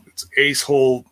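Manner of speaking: ordinary talk, nothing marked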